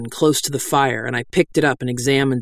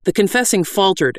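ordinary delivery

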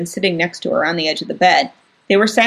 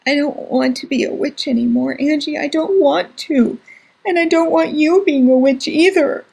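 high, tearful pleading; crying; 8 semitones higher than usual. Acted. Crying boy begging his sister to stop being witches, tearful. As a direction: beg through tears. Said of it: "He was crying so hard".

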